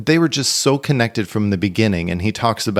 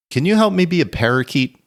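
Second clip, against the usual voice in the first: higher woman's voice, lightly imitated; imitating Gilda; 8 semitones higher than usual. Gilda asks a nervous writer behind a plant, in a higher lightly imitated voice. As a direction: pitch it up into a light impression of a young woman. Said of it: "a woman's voice say".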